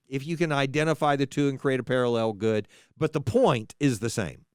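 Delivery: normal talking voice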